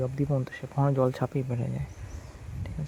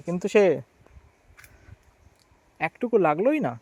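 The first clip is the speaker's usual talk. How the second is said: calling out; 4 semitones higher, 6 dB louder than usual. Real, not acted. Scolding a sleeping dog loudly.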